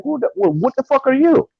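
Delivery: high-pitched, incredulous challenge; indignant retort. Retold or imitated. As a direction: throw it back as an offended question. Acted retort to condescending police.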